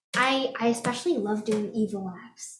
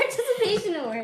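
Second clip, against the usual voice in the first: laughing; 9 semitones higher, faster than usual. Real, not acted. Laughing echo.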